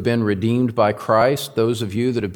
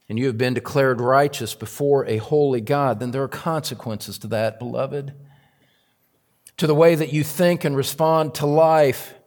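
ordinary delivery

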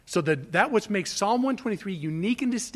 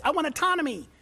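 higher, mock-indignant complaint; mock whiny flesh; 8 semitones higher, 5 dB louder than usual. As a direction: sulk like a spoiled child. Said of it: "your flesh says".

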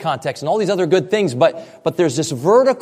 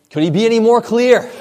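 exasperated; 7 semitones higher, 5 dB louder than usual. Real, not acted. Exasperated rhetorical question.

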